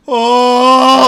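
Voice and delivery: long, loud, high 'oh'; drawn-out roaring audience 'ohhh'; crowd gasp imitation. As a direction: imitate a whole theater freaking out. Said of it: "I heard everybody in the theater go".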